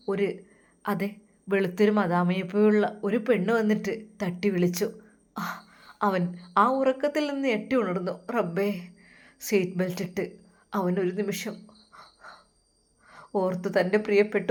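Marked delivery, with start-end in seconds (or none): none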